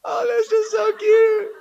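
very high, loud and slow gushing coo; adult leaning over a crib, cooing; cooing baby talk. Retold or imitated. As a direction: gush right in the baby's face. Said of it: "someone's probably in his face like".

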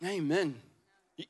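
ordinary talk

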